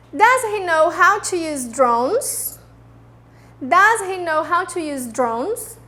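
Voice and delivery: slow, clear question for students to repeat; slow model sentence. Shown as a demonstration. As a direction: read it slowly so they can echo. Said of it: "depois eu vou dar o tempo e vocês repetem".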